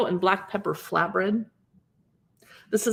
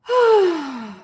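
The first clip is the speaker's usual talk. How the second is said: longing sigh; 8 semitones higher, 5 dB louder than usual. Real, not acted. Dreamy sigh over pizza photos.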